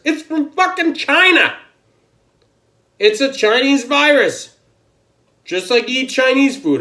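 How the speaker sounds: real exasperated shouting; angry shout. Real, not acted. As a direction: shout it out in outrage. Angry rant about racism charge.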